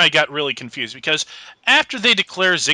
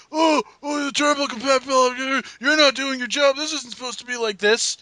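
high-pitched mock protest; hammed-up high scolding voice; imitated yelling complaint; 8 semitones higher than usual. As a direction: whine it up high, quick complaints at the referee. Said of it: "starts yelling at the referee".

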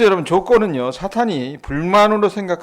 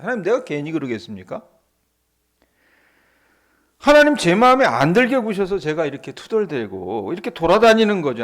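defiant Satan; 3 semitones higher, 6 dB louder than usual. Acted. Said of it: "하는 반항이 들어있는 거예요".

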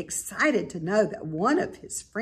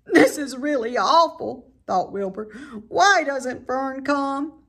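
distressed piglet; 6 semitones higher, 4 dB louder than usual. A narrator's reading. Wilbur about to cry.

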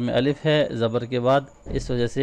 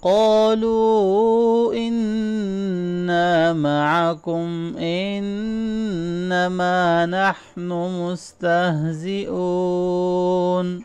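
slow, higher melodic Quran recitation; Quranic recitation; 6 semitones higher, 4 dB louder, much slower than usual. Acted. Teacher reciting the full verse for students, melodic and slow.

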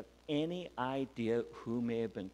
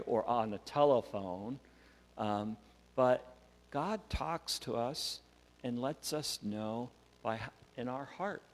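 usual speaking voice

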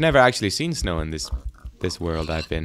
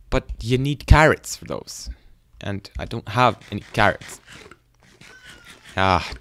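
ordinary talk

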